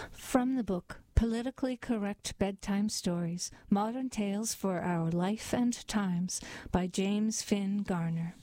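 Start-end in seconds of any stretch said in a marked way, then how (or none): none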